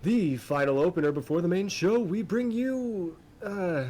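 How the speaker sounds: booming high announcer voice, loud and showy; arena announcer. Acted. Arena announcer addressing the crowd, booming and showy. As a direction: ring announcer hyping the crowd to the fights. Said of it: "And he begins".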